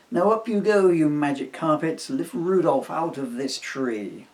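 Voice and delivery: calls the carpets up loudly, full voice; like a young magician casting a spell; loud magic command. Acted. Emily summons the magic carpets to lift Rudolph, loud and commanding.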